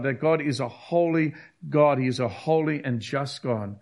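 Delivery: ordinary delivery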